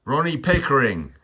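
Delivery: high, loud indignant shout; Ronnie Pickering. Acted. Vlogger sidetracked by a surname, loud hammed-up impression. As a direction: bellow it as a mock-outraged braggart. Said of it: "gun at Pickering".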